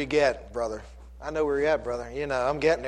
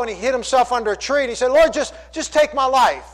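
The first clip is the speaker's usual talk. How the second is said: raised, pleading sermon retelling; impassioned preaching; 6 semitones higher, 7 dB louder than usual. Acted. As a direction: preach the prophet's desperate plea. Preaches Elijah's plea.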